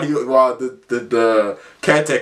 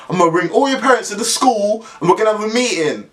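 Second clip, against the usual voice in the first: mock threat; 9 semitones higher than usual. Caretaker threatening boys.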